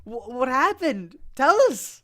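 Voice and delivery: high, drawn-out dramatic demand; urgent pleading. Acted. Pressing grandmother for what happened, urgent. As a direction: beg for the news, melodramatically.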